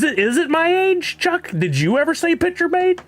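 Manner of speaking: playful high-pitched jab at Chuck; squeaky high question. Real, not acted.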